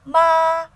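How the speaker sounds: MA high, loud and level; first tone. Shown as a demonstration. Teacher recapping four tones, high level MA. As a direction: hold MA steady at the top. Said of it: "we have first tone".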